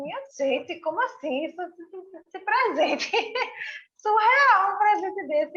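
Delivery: high, laughing disbelief; amazed with laughter. Retold or imitated. Guest quoting her amazed thanks, high and laughing. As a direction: gasp through laughs.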